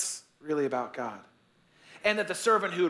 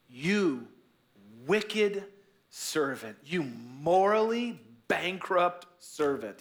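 slow rebuke; much slower than usual. Acted. Master rebukes servant.

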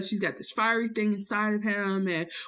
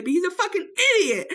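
laughing outburst; 10 semitones higher, faster than usual. Real, not acted.

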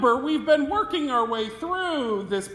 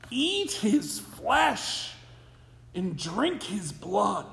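slow quoted words; slower than usual. Acted. Quoting Jesus' hard words.

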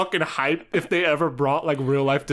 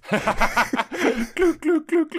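mock sound effect; 10 semitones higher, much slower than usual. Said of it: "uh, sound effect".

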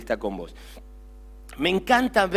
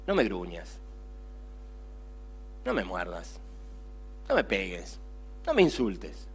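gentle plea; 8 semitones lower, 10 dB quieter than usual. Acted. Preacher pleads softly with listener.

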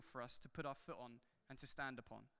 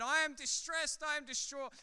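hammed-up distress, pitched higher; mock wailing; 9 semitones higher, 6 dB louder than usual. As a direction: play-act a wailing mourner, mildly. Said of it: "come weeping and wailing".

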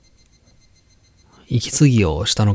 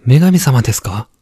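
put-on higher, eager child calling; young boy voice; 3 semitones higher, 5 dB louder than usual. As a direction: call out like an excited boy. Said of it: "男の子は喜んでくれたようだ".

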